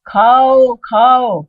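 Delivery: loud, high, drawn-out urging; sudden commanding voice. Retold or imitated. Baba urging the visitor to eat, calling out suddenly. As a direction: imitate a guru's voice calling out to eat. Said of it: "इतने में आवाज".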